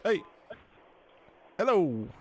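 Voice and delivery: ordinary delivery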